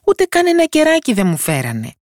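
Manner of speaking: high-pitched tiny complaining voice; poor tiny character's lament; hapless little fellow. A narrator's reading. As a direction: grumble in a small, high voice. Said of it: "είπε ο δύστιχος Τοσοδούλης".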